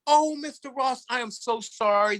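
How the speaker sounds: apologetic commissioner. Retold or imitated.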